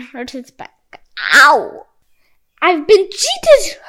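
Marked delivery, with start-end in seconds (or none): 1.2-1.9 loud sudden yelp of pain; pained cry. A narrator's reading. Priest hurting his back in a fall, loud yelp. 2.6-3.8 high, loud, slow outraged cry; steaming. A narrator's reading. Priest realizing the kettle cheated him, loud outrage. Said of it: "he steamed".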